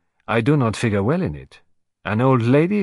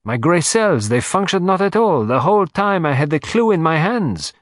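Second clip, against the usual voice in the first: higher and quicker, self-reproaching Poirot; animated; 5 semitones higher, 3 dB louder than usual. Acted. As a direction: burst out with animated frustration. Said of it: "becoming suddenly animated".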